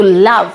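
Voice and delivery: লাভ with a short আ vowel, said firmly; English word pronunciation. Shown as a demonstration. Teacher modelling love for students, clear and stressed. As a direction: hit the আ in লাভ. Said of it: "ও এর উচ্চারণটা কিন্তু আমরা আ এর মতোই".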